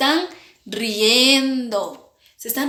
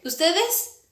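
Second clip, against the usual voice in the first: normal talking voice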